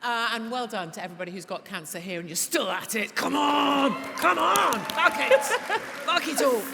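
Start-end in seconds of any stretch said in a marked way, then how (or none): 3.1-6.6 rallying shout. Real, not acted. Rallying the crowd in defiance, loud shout.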